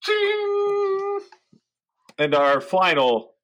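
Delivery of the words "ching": high mouth bell ching. Acted. Bell sound for final number.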